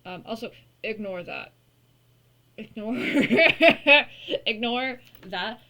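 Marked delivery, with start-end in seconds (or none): 2.6-5.6 laughing. Real, not acted. Laughing at messy laundry.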